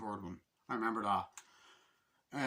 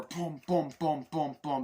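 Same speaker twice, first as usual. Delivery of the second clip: loud, punchy run of booms; comic fight sound effects; imitated blows; 3 semitones higher, 5 dB louder, slower than usual. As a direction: pound out each hit as a noise.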